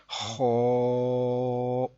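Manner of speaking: خو with held, rounded heavy vowel; full, thick letter. Shown as a demonstration. Teacher shows why it is read full, heavy drawn-out خو. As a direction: thicken خو and sustain it evenly. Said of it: "اس کو ہم پر پڑھیں گے".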